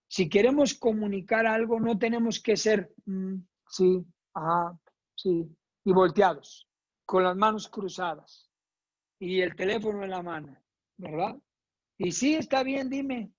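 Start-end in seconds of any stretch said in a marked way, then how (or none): 3.0-5.5 distracted, dismissive mm-hm; half-listening while looking away; bored listener. Shown as a demonstration. Instructora imita a alguien distraído que no escucha. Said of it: "demo of bad listening". 12.0-13.3 higher, offhand dime trailing off; dismissive reply. Shown as a demonstration. Instructora actúa a alguien que ignora, con desgano. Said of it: "Eso no".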